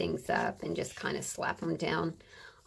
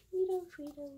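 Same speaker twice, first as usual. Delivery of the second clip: higher, playful sing-song take on Nito Frito; 9 semitones higher, faster than usual. Acted. Crafter repeating a funny label in a playful high voice.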